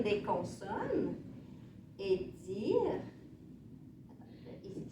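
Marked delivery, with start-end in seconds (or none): 4.1-4.8 whispered under the breath; whispered aside. Real, not acted. Teacher whispers an aside while checking the sheet. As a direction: whisper it to yourself, barely voiced.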